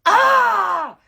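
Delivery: loud, high shrieking scream; imitated scream of an enraged ex; guttural scream. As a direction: let out a raw furious scream. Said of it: "she lets out this guttural scream".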